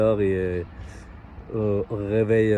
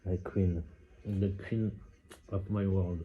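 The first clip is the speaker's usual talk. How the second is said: soft, intimate murmur, much quieter; gently hushed sweet talk; tender hush; 3 semitones lower, 9 dB quieter than usual. Real, not acted. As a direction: murmur it close, like a love confession. Tender words to a partner.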